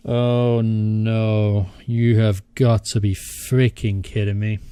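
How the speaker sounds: loud, slowed-down disbelief at the goal; exasperated groan. Real, not acted. Player groaning at his team conceding, loud and exasperated. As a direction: drag it out, fed up and loud.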